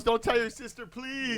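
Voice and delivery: high mock-mom plea. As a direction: beg like a guilty mother. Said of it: "she goes".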